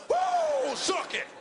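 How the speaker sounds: a wild high whoop at full volume; screaming. Retold or imitated. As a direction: whoop it out like a pumped-up wrestler. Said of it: "Just screaming".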